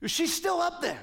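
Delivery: put-on high, light child's voice; a child's matter-of-fact reply; little girl answering. Granddaughter telling grandpa her sister is still up top, high child's voice.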